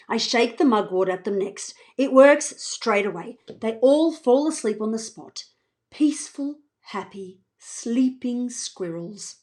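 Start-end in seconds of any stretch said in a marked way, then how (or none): 5.9-9.4 whispered, slow and soft; hushed whisper. A narrator's reading. Narrator over sleeping squirrels, whispering slowly. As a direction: hush it like not to wake them.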